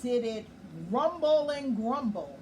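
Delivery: ordinary delivery, nothing marked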